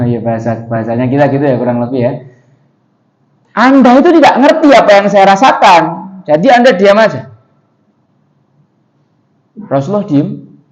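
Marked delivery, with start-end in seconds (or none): 3.5-7.3 loud, high furious snapping; angry outburst. Acted. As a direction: lash out in raw grief and anger. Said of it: "nyerocos sambil marah". (ibu marah membentak Rasulullah, nada tinggi dan keras)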